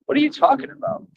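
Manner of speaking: very high, baffled question; incredulous. Real, not acted. Dismissing a rival who has no platform, incredulous. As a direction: ask it high, in utter disbelief. Repeats incredulous reply to accusation.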